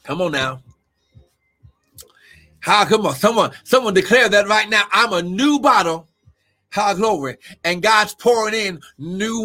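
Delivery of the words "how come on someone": ordinary delivery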